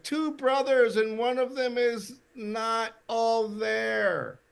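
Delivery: high, stretched-out heckler's shout; imitated shout from the crowd; audience call-out. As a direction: call a suggestion up to the stage, drawing out the end. Audience member calls out suggestion.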